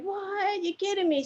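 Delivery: high-pitched mock disbelief; incredulous. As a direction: act out a disbelieving cashier. Acting out cashier's disbelieving reply.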